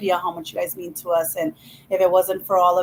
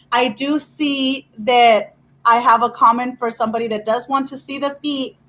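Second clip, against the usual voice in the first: ordinary talk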